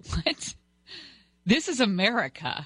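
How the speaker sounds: mock shocked American; mock outrage. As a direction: play your own indignation. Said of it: "And I said".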